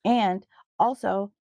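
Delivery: normal talking voice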